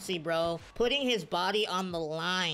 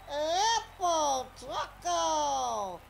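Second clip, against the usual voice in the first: drawn-out call that cracks up high; 5 semitones higher, much slower than usual. Real, not acted. Gamer calling a player's name, voice cracking slowly. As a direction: stretch it slowly and let the voice crack. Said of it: "Voice crack".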